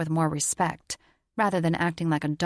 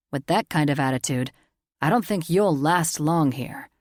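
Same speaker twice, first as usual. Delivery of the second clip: gruff uncle rebuke; 4 dB louder than usual. A narrator's reading.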